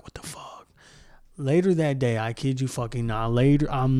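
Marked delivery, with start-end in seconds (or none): 0.0-0.7 breathy whispered disbelief, barely audible; stunned whisper. Retold or imitated. Whispering his shock at the classmate's comment, stunned whisper. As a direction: mouth it under your breath in shock. Classmate's racist remark, inner reaction.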